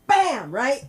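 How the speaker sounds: high, punchy bam; mock impact sound. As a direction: hit the bam like a punch landing. Acting out knocking the book.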